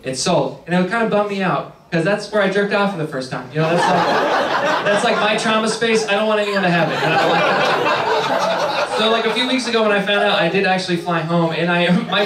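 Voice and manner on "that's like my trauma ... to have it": ordinary delivery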